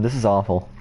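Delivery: ordinary delivery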